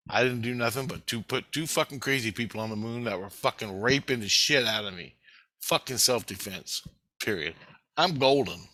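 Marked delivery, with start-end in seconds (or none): none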